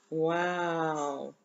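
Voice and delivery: amazed gasp. Acted. Showing a new picture.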